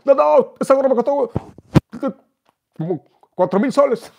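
very high, loud, frantic wail. Retold or imitated. Celebrity wailing to the teller about his stolen cap, very high and loud.

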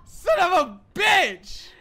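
loud, high outburst of swearing; high-pitched cursing shout; frustrated yell. Real, not acted. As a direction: yell it in gamer rage. Losing a game, raging.

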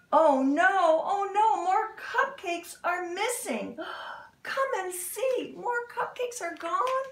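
hammed-up panic rising to a squeal; mock alarm. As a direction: sound shocked for the children. More cupcakes missing.

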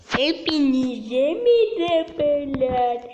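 loud, drawn-out greeting; video intro shout. Real, not acted. Young streamer greeting viewers, loud drawn-out intro. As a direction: call out a big, slow hello to the audience.

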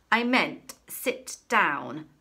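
slow, loud, deliberate bark. A narrator's reading. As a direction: spell it out through fury. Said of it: "barked Miss Prigg".

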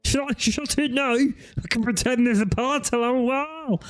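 high put-on accomplice voice; Melvin. Mocking the hidden mate behind the door, high put-on voice. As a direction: mimic a panicked helper in a high voice. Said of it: "And Melvin's like".

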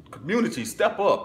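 high, loud call to the crowd; rallying shout. Real, not acted. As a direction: call everyone to step up. Calling on the community.

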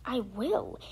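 ordinary delivery

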